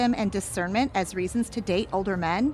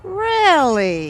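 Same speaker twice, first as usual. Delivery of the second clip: higher and louder sudden question; surprised; 5 semitones higher, 8 dB louder than usual. Acted. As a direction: caught off guard by the gossip. Waitress overhears affair rumour.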